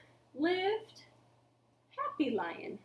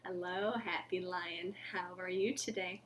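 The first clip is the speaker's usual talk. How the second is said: put-on soft old lady; 7 semitones lower, 10 dB quieter than usual. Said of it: "Missus Pinson would say".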